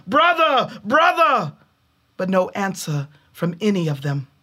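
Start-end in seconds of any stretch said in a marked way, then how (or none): 0.1-1.5 high call down into the pit. A narrator's reading. Older brother calling down the pit traps, high call. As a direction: call down the trap, searching. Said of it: "called down".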